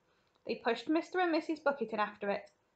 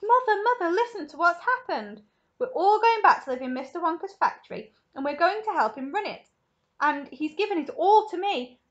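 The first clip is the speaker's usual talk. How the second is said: high, loud breathless excitement; excited child; 8 semitones higher, 7 dB louder than usual. Acted. Charlie tells his mother the news, high and excited. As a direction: tumble the news out eagerly.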